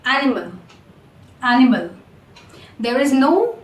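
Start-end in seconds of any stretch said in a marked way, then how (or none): 0.1-1.9 animal ending in a light "mul" with schwa; unstressed last syllable. Shown as a demonstration. Teacher modelling the schwa in animal, unstressed ending. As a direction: say animal with no stress on the final A. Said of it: "stress on the last syllable A".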